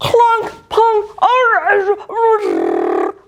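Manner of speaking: high shrill mock crashes and cries; cartoon crash and yelps. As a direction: voice the clatter and yelping. Background noises in picture.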